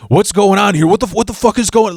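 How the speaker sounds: high, fast, heated; imitated furious husband; angry confrontation. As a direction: demand answers aggressively.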